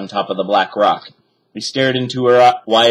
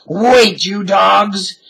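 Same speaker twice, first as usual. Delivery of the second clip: angry shout; 10 semitones higher, 5 dB louder, much slower than usual. A narrator's reading. Captain chases his men.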